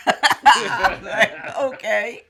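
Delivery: slow laughing okay. Real, not acted. Mother laughing at her son's story. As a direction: chuckle through the words.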